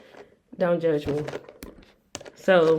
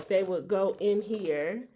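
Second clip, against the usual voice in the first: normal talking voice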